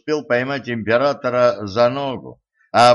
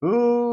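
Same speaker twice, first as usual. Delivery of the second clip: high-pitched dismayed "ooh"; upset "ooh"; 13 semitones higher than usual. A narrator's reading. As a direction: let out a high, drawn-out sound of dismay. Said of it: "расстроился Ланс". (огорчённый возглас)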